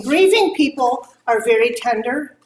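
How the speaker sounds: ordinary delivery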